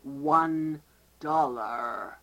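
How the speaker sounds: drawn out, flat clerk voice; very slow deadpan clerk. Acted. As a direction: stretch "one dollar" very slowly. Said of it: "I'm the clerk".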